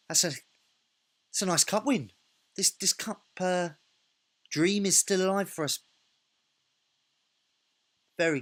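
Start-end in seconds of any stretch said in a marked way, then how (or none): 0.1-5.8 excited. Real, not acted. Full-time whistle, cup win.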